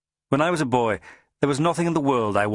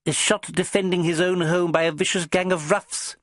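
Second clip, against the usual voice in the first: high, teasing spymaster voice; amused little boss; 8 semitones higher than usual. A narrator's reading. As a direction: tease him from up high. Boss interjects.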